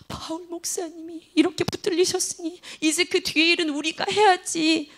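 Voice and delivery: soft, gentle and caring, drawn out at the end; soothing, like consoling fellow believers; tender compassionate voice. Retold or imitated. Preacher voices the kind believers gently.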